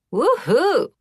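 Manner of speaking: high, loud whoop of delight; excited yelp. A narrator's reading. Hungry girl cheering for dinner, excited yelp. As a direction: cheer it out, thrilled. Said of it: "Mia yelped".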